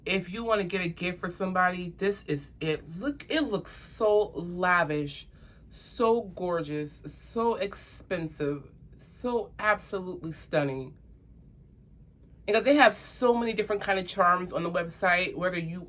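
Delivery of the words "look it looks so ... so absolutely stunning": savouring. Real, not acted.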